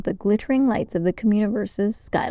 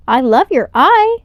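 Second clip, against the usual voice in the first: high, bright alien greeting; an eager alien, voiced higher; 6 semitones higher, 8 dB louder than usual. A narrator's reading. Alien greets Elio warmly.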